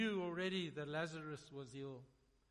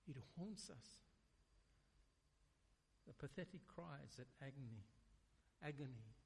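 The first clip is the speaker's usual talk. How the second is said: hushed preaching; 4 semitones lower, 9 dB quieter than usual. Acted. Preacher brooding over regret to the congregation, hushed and grave. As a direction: lower your voice and let it weigh.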